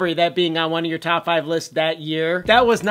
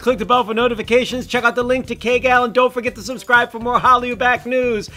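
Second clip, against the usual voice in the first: upbeat outro; 6 semitones higher, 5 dB louder than usual. Acted. YouTube outro call to action.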